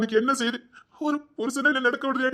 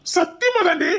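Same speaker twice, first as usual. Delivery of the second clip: crying; 9 semitones higher, 4 dB louder, slower than usual. Acted. Husband swears he hasn't eaten.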